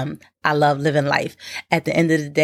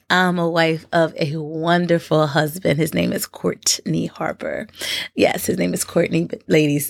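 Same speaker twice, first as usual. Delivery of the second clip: usual speaking voice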